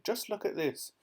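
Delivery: high, thrilled call upward; excited shouting. A narrator's reading. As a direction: call him down, bursting with news. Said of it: "she was shouting".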